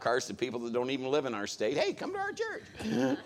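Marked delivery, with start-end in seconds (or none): 1.8-3.2 high, slow, sing-song mock invitation; eager church recruiter. Preacher mimics people inviting strangers to church, high and sing-song. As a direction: parody an overeager church greeter. Mocking people handing out cards.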